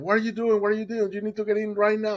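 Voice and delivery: high, urgent mock yell; retold screaming. Boatman to swimmer, screaming at him to get in. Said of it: "he started screaming at me".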